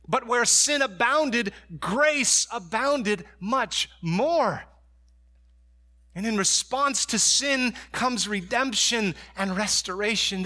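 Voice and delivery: high, loud, swelling preacher delivery; full-force preaching. Acted. Pastor preaching grace to the church, at full force. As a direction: preach it out to the whole congregation. Preacher building to the gospel point.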